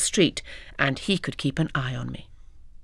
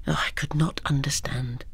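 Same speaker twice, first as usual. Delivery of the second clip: bewildered whisper; 4 dB quieter than usual. A narrator's reading.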